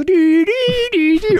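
high, loud vocal siren; police siren imitation. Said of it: "man sieht immer so die Polizei so".

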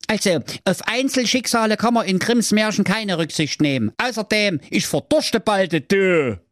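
put-on high offhand boyish voice; dismissive Brudi. Brudi brushing off his sister, high offhand voice. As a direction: brush her off casually.

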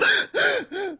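high, repeated sharp intake of breath; imitated annoying noise. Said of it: "Zum Beispiel dieses Geräusch".

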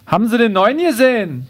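put-on gossipy neighbour asking; nosy neighbour. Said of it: "fragt sie".

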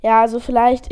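usual speaking voice

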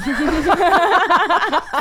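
loud high burst of laughter. Real, not acted. Laughing loudly at her own silly joke.

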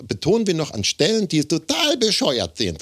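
high, lisping, with oddly placed stress; slushy lisp. Shown as a demonstration. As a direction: lisp and roll the R like a pompous critic. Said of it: "Einerseits lispelt der".

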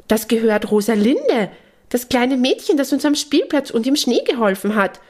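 high excited squealing; thrilled high-pitched outburst. Acted. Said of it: "kreischt Hanni aufgeregt".

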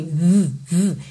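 buzzing "V, v" with the vocal cords on; voiced v sound. Shown as a demonstration. Teacher showing the voiced TH as a v. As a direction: hum a voiced "v". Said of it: "Tem pregas vocais funcionando aí".

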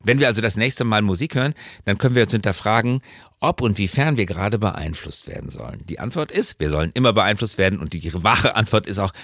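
ordinary talk

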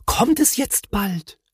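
put-on high, slow child-like voice; young Moomin's question. A narrator's reading. Moomin asking the Hemul about Christmas, high and questioning. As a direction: ask it high and drawn out.

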